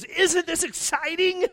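high, slow, gushing wife voice; excited wife. Retold or imitated. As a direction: squeal it like a thrilled wife. Wife voiced in retold story.

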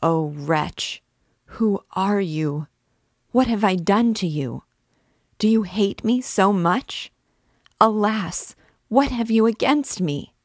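put-on the girl pleads with the priest, rising in sobbing despair. A narrator's reading. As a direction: beg him through tears, building. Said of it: "bursting into sobs".

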